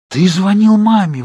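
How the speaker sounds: horrified shout. A narrator's reading. Bella horrified that Charlie called her mother. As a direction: cry out in horror. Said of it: "в ужасе закричала я".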